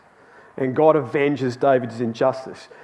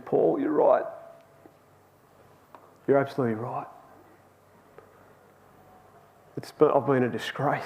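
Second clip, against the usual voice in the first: quiet, halting admission with long pauses; retold near tears; 6 dB quieter than usual. Crewmate admitting fault to his colleague, retold as nearly crying. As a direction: softly imitate a man humbly owning up. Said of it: "his voice is quivering like he's nearly crying".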